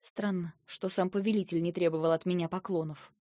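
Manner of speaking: usual speaking voice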